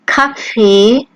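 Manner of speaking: Kaffee as ka-feh with a hard k; German Kaffee, hard k, ka-feh; German pronunciation. Shown as a demonstration. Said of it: "as in the words".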